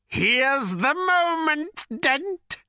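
very high, slow, shrieking hatred. A narrator's reading. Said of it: "shrieked the voice".

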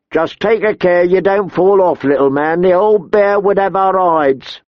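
high, mocking guard voice; second winchman. A narrator's reading. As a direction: taunt the newcomer from above. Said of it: "the other one said".

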